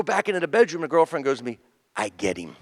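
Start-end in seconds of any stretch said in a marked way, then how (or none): 1.9-2.6 low, soft retold confession; quiet admission. Girlfriend confides in the bedroom, quiet admission. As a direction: drop it down like a private concession.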